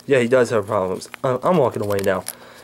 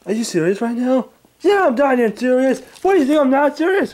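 Angry Grandpa voice; 11 semitones higher than usual. Acted. Old man woken by pranksters, high cranky yelling. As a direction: bark at the intruders like a furious grandpa.

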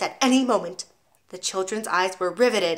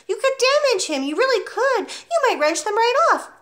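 high-pitched urgent warning; distressed teacher imploring; begging; 14 semitones higher, 3 dB louder than usual. A narrator's reading. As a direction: plead hard, voice high and insistent. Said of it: "begged Miss Honey".

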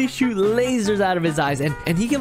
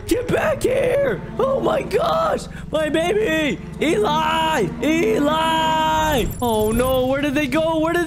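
panicked high yelling after someone; frantic shouting; 7 semitones higher than usual. Acted. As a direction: scream after them in panic. Parent shouting after kidnapped baby.

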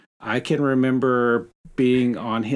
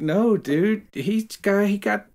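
higher, louder exasperated protest; retold protest; 9 semitones higher, 5 dB louder than usual. Telling friend Todd not to fight, higher and louder. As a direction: wave off a friend's revenge plan. And I'm like.

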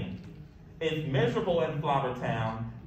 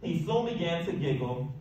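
usual speaking voice

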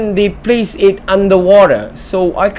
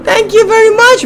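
much higher and louder, gushing with fake sobs; mock tearful joy; 13 semitones higher, 4 dB louder than usual. The student thanks the stone for friendship, squealing high through fake sobs. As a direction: overdo the grateful happy crying. Overjoyed at being called friend.

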